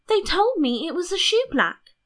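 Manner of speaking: high, lilting princess. A narrator's reading. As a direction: pitch it up for a princess's remark.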